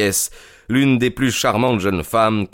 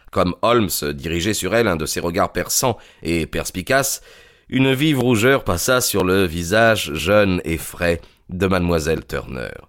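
usual speaking voice

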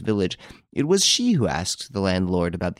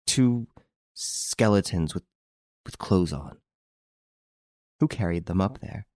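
stammering; 3 dB quieter than usual. Acted. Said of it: "stammered Hortense".

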